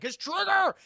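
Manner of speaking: high, loud exclamation; excited outburst. Real, not acted. Exclaims over Blob being set off.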